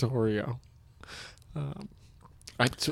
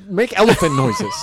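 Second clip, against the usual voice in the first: laughing; 9 semitones higher, 6 dB louder than usual. Real, not acted. Absurd order, cracking up.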